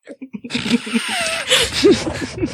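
big high-pitched laugh that takes over; high burst of laughter. Real, not acted. Laughs at the hobo description.

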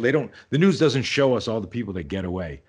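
ordinary talk, nothing marked

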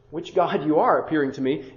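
usual speaking voice

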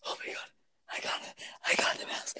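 voiceless, hushed whisper of disbelief; stunned whisper. Retold or imitated. Girl telling Karen she caught the mouse, stunned whisper. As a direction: whisper it, acting out amazed triumph.

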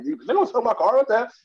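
lightly voiced high indignant refusal; a hint of the offended car owner, pitched high; mock indignant character. Character refusing to sell his car, mock indignant.

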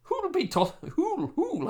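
put-on stumbling Welsh accent, high pitched. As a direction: trip over the words in Welsh accent. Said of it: "how the hell do you do that in Welsh?".

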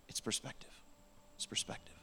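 near-whisper, very soft; hushed. Real, not acted. Preacher telling congregation why we do it, hushed. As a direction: drop to a whisper for the key point.

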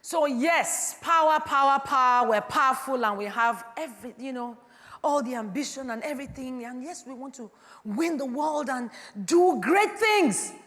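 loud, high, ringing pulpit voice; full-force preaching. Acted. Pastor rousing the congregation, preaching at full force. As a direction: preach it out to the whole hall.

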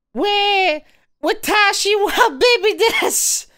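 put-on very high wailing baby cry; crying Japanese baby voice. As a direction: wail it like a crying infant. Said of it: "how a little Japanese baby would cry".

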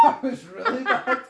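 high laughing reaction; giggling at a bad accent. Real, not acted. Laughing at awful accent attempt.

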